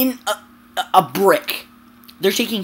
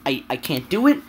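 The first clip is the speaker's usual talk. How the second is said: ordinary delivery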